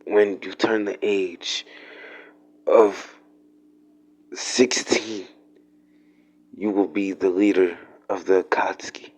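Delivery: slow, faint last-breath voice; mock deathbed gasp, slow and soft; dying breath. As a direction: lightly play a dying man pushing out words. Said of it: "with the little breath he has".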